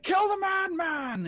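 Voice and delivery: high, slow drawn-out shout; mob yell. Acted. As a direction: bellow it like an angry crowd. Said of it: "the mob is yelling".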